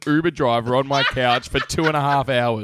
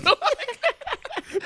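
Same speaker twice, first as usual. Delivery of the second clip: high laugh; 4 dB quieter than usual. Real, not acted. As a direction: burst out laughing. Laughing at own bit.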